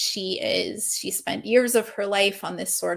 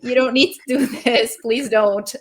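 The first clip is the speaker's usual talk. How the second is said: louder, half-laughing mock plea; playful, raised-voice appeal; pleading intervention; 4 semitones higher, 6 dB louder than usual. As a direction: imitate worried loved ones calling out. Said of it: "people trying to tell her".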